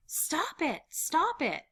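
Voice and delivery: high-pitched cry to stop; upset plea. Acted. Pleading during the potato dividing, upset.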